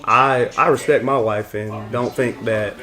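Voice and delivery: low, level, measured statement; formal disclaimer. Acted. Disclaiming [name]'s actions to friends, formal and low. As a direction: deliver it like a prepared public statement.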